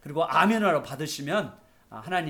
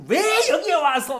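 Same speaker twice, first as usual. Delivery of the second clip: scolding; 10 semitones higher, 6 dB louder, slower than usual. Retold or imitated. Acting the disciples scolding children.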